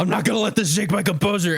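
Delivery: fast, high, hammed-up Vegeta; mock Vegeta fury. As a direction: rush it like Vegeta barely holding it in. Mock furious Vegeta line.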